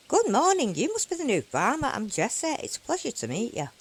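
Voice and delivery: high, loud cheery villager greeting; a chirpy townsperson welcoming a newcomer; Jesse voice. Acted. Voicing Jesse character.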